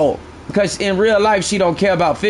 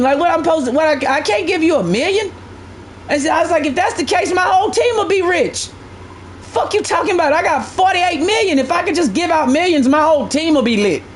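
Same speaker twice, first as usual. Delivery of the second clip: higher, worked-up retold rant; higher-pitched mock outrage; incredulous rant; 7 semitones higher than usual. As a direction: replay your disbelief at the viewer. Retelling his angry reply to viewer.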